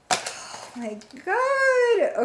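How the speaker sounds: very high, hugely stretched squeal; overwhelmed with delight, squealing slowly; excited squeal. Real, not acted. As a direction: draw it out into a long high squeal. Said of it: "I'm so excited".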